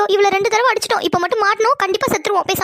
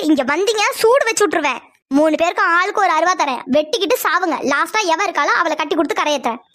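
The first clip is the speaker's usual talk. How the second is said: ordinary talk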